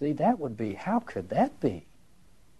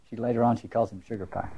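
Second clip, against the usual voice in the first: ordinary talk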